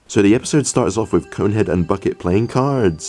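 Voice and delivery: ordinary talk, nothing marked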